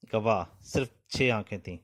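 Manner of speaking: usual speaking voice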